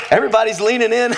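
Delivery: big rolling laugh, then quick laughing words; real laughing. Real, not acted. Laughs at the attentive crowd.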